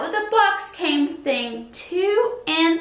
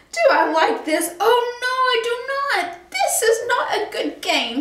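high, fussy fish voice; a prim little fish, indignant; 8 semitones higher than usual. A narrator's reading. The fish objects to the cat's game, high and fussy. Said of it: "said our fish".

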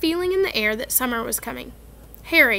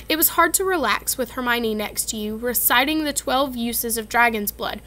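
plain reading voice

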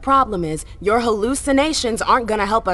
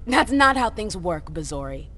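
usual speaking voice

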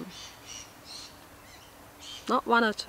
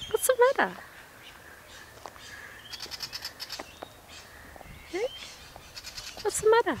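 much higher squeaky pet talk; 13 semitones higher, 5 dB quieter, faster than usual. Real, not acted. Asking the swooping bird what's wrong, very high baby talk.

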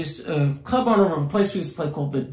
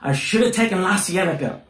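imitating upset driver; 5 semitones higher than usual. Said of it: "he keeps on saying".

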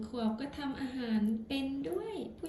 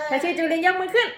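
calling out; 5 semitones higher, 12 dB louder than usual. Real, not acted. Calling out to toddler.

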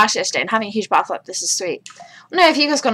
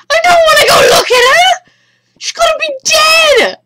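very high, loud, frightened whine; panicked wail; 5 dB louder than usual. Real, not acted. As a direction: wail it in dread. Dreads looking in horror game.

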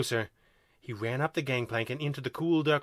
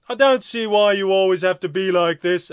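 higher, louder complaining alien voice; querulous second alien; 5 semitones higher, 7 dB louder than usual. A narrator's reading. Second alien griping at his partner, querulous. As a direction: complain loudly in a raised alien voice.

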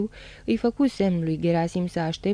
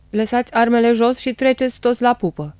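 loud, higher-pitched command; shouted order; 4 semitones higher, 7 dB louder than usual. A narrator's reading. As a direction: bellow orders at the whole crew. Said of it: "porunci Spânu". (poruncă strigată tare)

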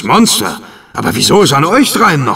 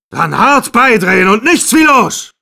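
shouted order; 9 semitones higher than usual. Acted. Captain orders crew to sail.

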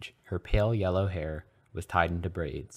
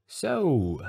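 nervous; 8 semitones higher, 4 dB louder than usual. A narrator's reading. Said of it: "Harry began nervously".

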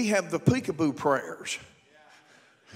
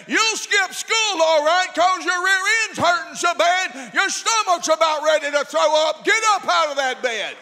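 high, loud, full-force scolding; acted tirade at a child who won't get up; furious parent shouting; 9 semitones higher, 4 dB louder than usual. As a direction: yell the threat, hammering every word. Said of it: "You tell your children, "Get up," and if they don't".

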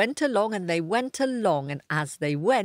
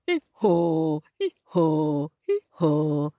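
pitched-down lead-singer donkey; donkey bray; 2 semitones lower, 3 dB louder, slower than usual. Acted. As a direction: bray with a long haw. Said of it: "I'll be the lead singer".

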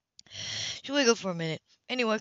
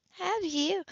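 teasing woman's voice; 11 semitones higher than usual. Acted. Heroine teasing.

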